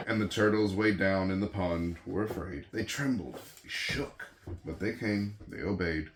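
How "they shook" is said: ordinary delivery, nothing marked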